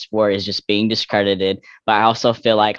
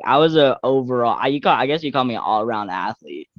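ordinary delivery

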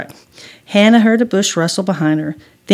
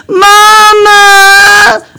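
high, loud, long wail; panicked scream; 14 semitones higher, 10 dB louder than usual. Acted. Scared boy running to mama, panicked scream. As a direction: scream for mom in terror. Frightened boy yells for mother.

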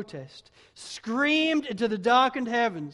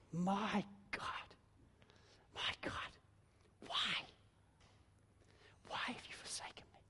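breathless, softer whisper of despair; anguished whisper; 15 dB quieter than usual. Acted. Jesus on the cross whispering to his Father in anguish. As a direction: hush it to a broken whisper.

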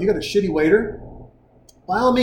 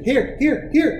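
put-on eager kid voice; 8 semitones higher, slower than usual. Kid eagerly offering tip money.

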